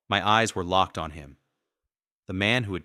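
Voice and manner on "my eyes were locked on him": ordinary delivery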